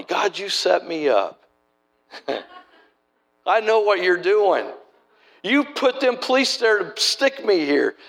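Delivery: high, slow, half-laughing accusation; arguing with God. Retold or imitated. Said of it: "arguing with him in my mind".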